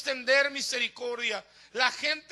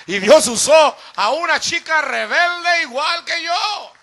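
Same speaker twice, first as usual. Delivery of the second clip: slow, very loud proclamation; climactic preaching shout; 3 semitones higher, 13 dB louder, slower than usual. Real, not acted. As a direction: build to a full-force testimony climax. Climax of the testimony, preached loudly.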